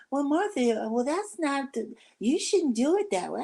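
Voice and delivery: high mock fussy voice; mimicking Martha. Imitating Martha objecting about the rice.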